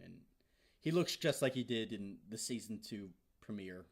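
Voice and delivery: ordinary talk, nothing marked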